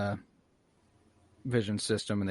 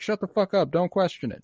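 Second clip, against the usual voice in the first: much higher, half-joking snap; mock snap; 8 semitones higher than usual. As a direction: tell them off in mock irritation. Crowd member snaps at another.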